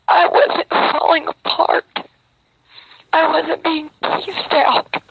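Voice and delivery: worn out and on the edge of crying; real exhausted tearful plea; emotional, near tears. Real, not acted. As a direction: voice climbing high, slowing, close to breaking. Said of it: "I'm getting emotional".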